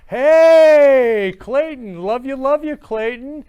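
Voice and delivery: high, loud greeting shout; excited call out. Real, not acted. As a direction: call out to him across the room. Calling out to a viewer.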